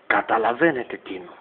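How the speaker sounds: normal talking voice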